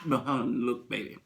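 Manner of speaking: usual speaking voice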